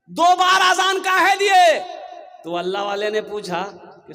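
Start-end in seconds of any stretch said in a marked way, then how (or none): 0.1-1.8 loud, high, slow demanding shout; angry confrontation. Acted. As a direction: yell it as an outraged accuser. Villagers angrily confront the muezzin.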